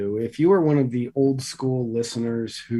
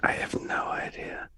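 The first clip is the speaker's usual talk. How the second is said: whispering; 5 dB quieter than usual. Pretend. Whisper segment.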